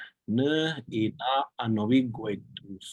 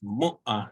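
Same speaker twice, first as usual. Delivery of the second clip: "mu'a" with MU stressed and "a" dropping; stress on first syllable. Shown as a demonstration. Teacher confirming the word for tomorrow, stress on first syllable. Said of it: "put the stress on that first syllable".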